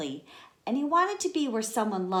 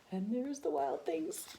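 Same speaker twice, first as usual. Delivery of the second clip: amazed gasp; 7 semitones higher, 7 dB quieter than usual. Acted. Teacher pointing at the monsters, gasping in wonder. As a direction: gasp then high wonder.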